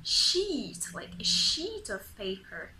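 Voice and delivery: ordinary talk, nothing marked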